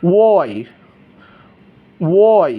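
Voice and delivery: ওয়াই as a drawn-out "wo-i"; rounded Y. Shown as a demonstration. Teacher drilling the corrected Y, rounded. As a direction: start ওয়াই from an "o". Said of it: "ও দিয়ে".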